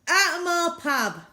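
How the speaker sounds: bellowed, high and loud; angry landlord yelling at a girl; furious shout. A narrator's reading. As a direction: roar him out of the pub. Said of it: "barked the landlord".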